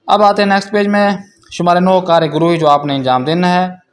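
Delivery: usual speaking voice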